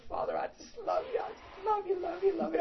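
high-pitched joyful gushing. Retold or imitated. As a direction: bubble over with love like a happy child. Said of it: "smiling, saying".

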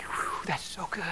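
hushed, breathy near-whisper; real quiet. Retold or imitated. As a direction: drop to an awed hush. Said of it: "I got real quiet".

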